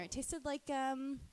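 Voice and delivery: ordinary talk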